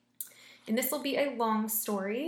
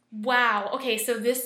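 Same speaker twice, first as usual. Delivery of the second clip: loud amazed wow; 8 dB louder, slower than usual. Real, not acted.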